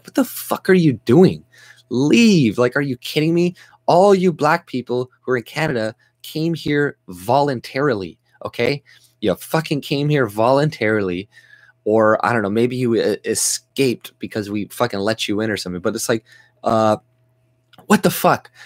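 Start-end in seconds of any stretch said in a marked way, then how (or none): 0.0-5.9 higher, louder angry shout; angry outburst. Real, not acted. Vlogger rants at viewers, angry raised voice. Angry rant about immigrants. 17.9-18.5 much higher, louder exasperated yell; angry outburst. Real, not acted. Vlogger rants about immigrants, exasperated angry yell.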